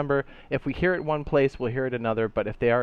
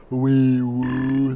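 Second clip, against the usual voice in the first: loud siren imitation; siren noise; 7 dB louder than usual. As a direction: wail it like a police siren. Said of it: "There it is".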